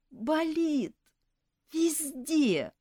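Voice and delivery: high, whiny moan; moaning elf. A narrator's reading. Hypochondriac elf moans to nurse, whiny. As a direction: moan it out like you're dying. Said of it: "простонал ипохондриэль".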